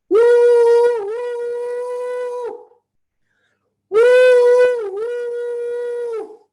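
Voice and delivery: high, loud train whistle hoots; train whistle woo. Acted. The train engine whistling as it leaves. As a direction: hoot like a toy train engine. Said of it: "the engine of the train went".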